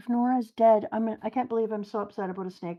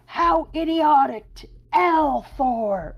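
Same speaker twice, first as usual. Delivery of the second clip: higher, louder slow yell; screaming out; 8 semitones higher, 5 dB louder, slower than usual. Acted. Enel unleashing lightning, loud slow yell. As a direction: bellow the attack name. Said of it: "Nora screams out".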